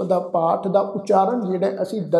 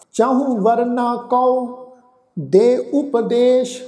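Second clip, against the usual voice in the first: slow, measured Gurbani path, a touch higher; scripture recitation; 3 semitones higher, 3 dB louder, much slower than usual. Acted. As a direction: intone each phrase with long pauses.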